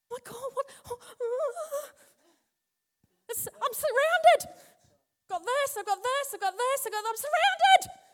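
high, crying, breathless panic; a hammed-up frightened wail; mock panic. Acting a panicked believer.

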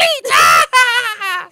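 very high, loud shrieking cackle; a camp villain's cackle; evil cackle. Pretend. Villain laugh after ritual line.

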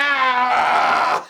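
long hammed-up scream; rage scream. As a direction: roar like waking in a fury. Imitates rage when squirted awake.

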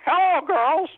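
high, chirpy talking-parrot voice. Acted. Parrot greeting the new lady of the house, high parrot voice. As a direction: play the brothel parrot greeting its new owner. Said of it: "the parrot sees them and goes".

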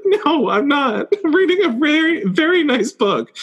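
very high, slowed tearful whine; mock weepy. Reader admitting tears to a sales associate, mock weepy. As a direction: play up being overwhelmed by a book. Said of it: "there was a lot of crying".

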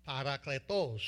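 Parakletos said softly in a joking Spanish accent; jokey Spanish take on Parakletos. Shown as a demonstration. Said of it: "how's my Spanish accent?".

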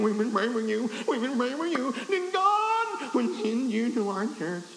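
high-pitched, little churchwoman voice; little lady's voice. Retold or imitated. Church lady welcoming the preacher, in a high little voice. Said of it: "I could hear her little voice saying".

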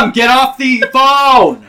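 put-on loud, high teen yell. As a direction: shout at mom to hang up. Acted teen yelling at mom.

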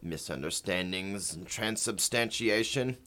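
lightly voiced porpoise on a phone line; a porpoise speaking through a phone; over the phone. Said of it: "We're gonna do a phone interview with them".

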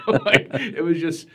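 high, slow, laughing words; laughing through words. Real, not acted. Man recalling his childhood prayer, laughing. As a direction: talk while still laughing.

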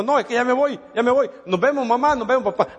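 a son who can't wait to get out the door; restless urgency. A narrator's reading. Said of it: "le pegó una desesperación". (hijo desesperado por irse, voz más aguda)

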